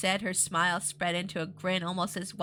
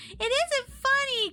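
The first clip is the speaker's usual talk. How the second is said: plain reading voice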